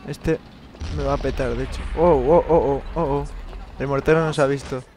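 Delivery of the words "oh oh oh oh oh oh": louder rising alarm; nervous yelps under fire; alarmed cries. Real, not acted. Taking fire. (alarma nerviosa)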